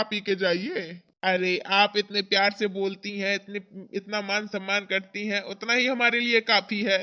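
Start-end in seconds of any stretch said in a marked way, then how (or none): none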